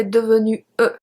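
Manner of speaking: "devenu E" with an extra vowel after the U; wrongly sounded E. Shown as a demonstration. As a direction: demonstrate the mistake, voicing the silent final E in "devenu E". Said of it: "We don't say".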